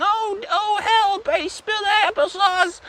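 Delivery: put-on high, drawn-out old lady whine; Janet whining. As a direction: wail weakly in a very high mock elderly voice. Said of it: "she starts whining and whining".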